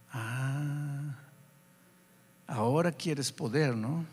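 low, soft, wry reproach; knowing inner voice. Retold or imitated. The Spirit answering the pastor's prayer, wryly reproachful. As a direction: let a long, low "ah" lead into a gentle taunt. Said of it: "una voz como diciendo".

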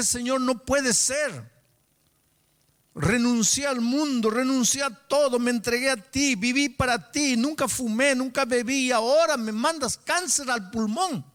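high, anguished retold outcry; crying out to God. Sick man on his knees protesting to God, crying out. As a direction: cry out in protest, pitched high and sustained. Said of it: "lloré y lloré y grité con Dios".

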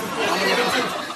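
slow, chuckling through the words; laughing repeat. Real, not acted. Comedian repeating his own punchline, laughing. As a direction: savor the punchline while laughing.

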